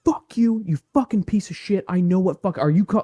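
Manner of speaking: hammed-up furious yelling at the caller; retold blowup. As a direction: scream the insults like you're losing it. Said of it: "blew up".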